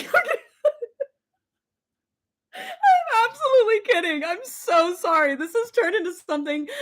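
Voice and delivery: high, shrieking laughter; laughing apology. Real, not acted. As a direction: giggle the apology out. Laughing apology after joke.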